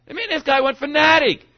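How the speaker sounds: high, full-force shout; fanatical yell. Shown as a demonstration. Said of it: "at how many decibels".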